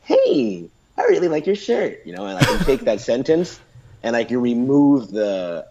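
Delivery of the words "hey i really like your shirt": very high, over-the-top American gush; phony American compliment, swooping high; fake American accent. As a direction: exaggerate a super fake American intonation. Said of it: "super fake American accent".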